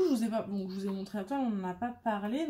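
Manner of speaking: usual speaking voice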